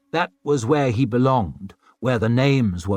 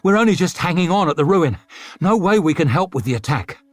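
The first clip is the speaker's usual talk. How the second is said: breathing hard; 6 semitones higher, 4 dB louder, faster than usual. A narrator's reading. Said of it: "breathing hard".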